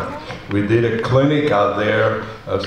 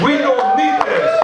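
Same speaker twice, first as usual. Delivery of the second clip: defiant cry. Real, not acted. Angry rally climax.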